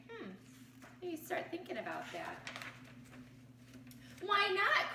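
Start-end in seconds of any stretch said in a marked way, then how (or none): 4.2-4.9 high, bright loud cheer; cheerful cry. Acted. The crowd cheering Kevin down, loud cry. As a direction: cheer him on. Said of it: "cried the Ruse with a super loud cheer".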